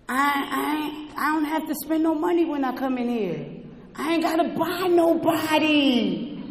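normal talking voice

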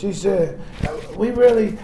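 usual speaking voice

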